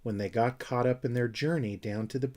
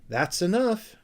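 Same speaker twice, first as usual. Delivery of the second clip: a light gasp, pitched higher; 8 semitones higher, 3 dB louder than usual. Acted. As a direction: catch your breath lightly on it. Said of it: "gasped Moomintroll at last".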